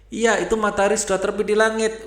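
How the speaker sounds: high, fast rooster voice; rooster insisting. Acted. Rooster insists to the owl the sun is up, high and fast. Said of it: "ujar si jago".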